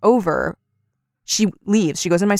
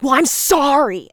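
drawn-out, high-pitched defensive sorry; grudging mock apology; 7 semitones higher, slower than usual. Mom apologizing to her daughter, imitated as grudging. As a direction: stretch out the sorry, pitched up and insincere. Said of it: "when she was apologizing to begin with".

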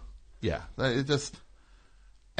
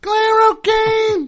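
announcer call; 11 dB louder, much slower than usual. Acted. Announcing a guest.

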